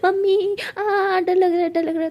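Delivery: high, loud panicked cries, slow; screaming for mum. Acted. Anokhi screams for her mother, frightened of the ghost. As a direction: wail for help in terror. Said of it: "ज़ोर से चिल्लाती".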